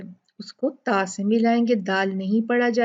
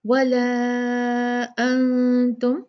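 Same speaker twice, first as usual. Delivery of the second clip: very slow chanted tajweed recitation; Quranic recitation; much slower than usual. Shown as a demonstration. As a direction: recite it, drawing out the madd. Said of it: "यहाँ पे क्या है?". (धीमी तजवीद वाली तिलावत)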